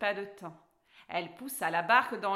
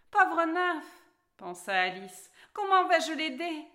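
worried; 6 semitones higher, 7 dB louder than usual. Acted. Alice worried about drowning nymph.

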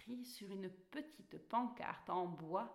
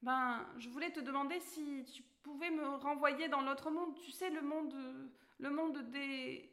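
put-on higher, bright girl character voice; Alice's voice; 6 semitones higher than usual. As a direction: play the polite young girl Alice, pitched up. Alice asks a favour.

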